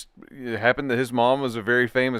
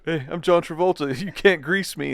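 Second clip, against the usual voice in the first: higher, joking celebrity impression; John Travolta impression; 6 semitones higher than usual. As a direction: playfully put on a star's taunt. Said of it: "John Travolta's like".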